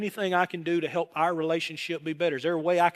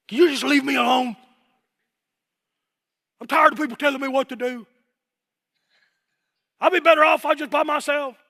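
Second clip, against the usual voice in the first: high, loud frustrated shouting; an angry person blowing up; raging outburst; 9 semitones higher, 6 dB louder than usual. Retold or imitated. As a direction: explode at everyone around. Voicing someone raging.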